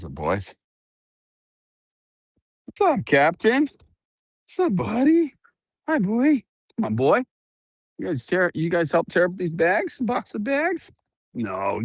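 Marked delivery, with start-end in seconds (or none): 2.7-10.9 dog talk. Real, not acted. Vlogger greeting her guard dogs, high pet voice. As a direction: coo at the dogs in a much higher voice.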